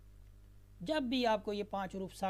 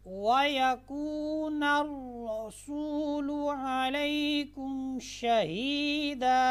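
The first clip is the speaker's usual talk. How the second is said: slow melodic chanted recitation, higher and louder; full recitation; 5 semitones higher, 5 dB louder, much slower than usual. Shown as a demonstration. Teacher recites the complete verse again for students. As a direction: recite the whole verse slowly in tones.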